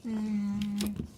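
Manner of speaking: loud, pleased mmm over a bite; hum of tasting food. Real, not acted. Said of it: "I'm gonna do a little mukbang".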